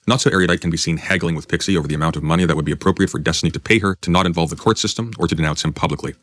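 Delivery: ordinary talk, nothing marked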